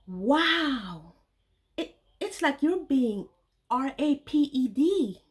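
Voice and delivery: shocked, spelling the word out slowly; stunned. Retold or imitated. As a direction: gasp it in disbelief.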